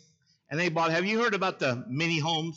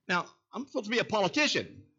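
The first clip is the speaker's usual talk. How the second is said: normal talking voice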